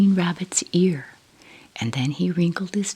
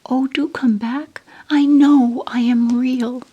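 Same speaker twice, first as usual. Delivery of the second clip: desperate plea; 9 semitones higher, 9 dB louder than usual. Acted. Little rabbit pleads after them.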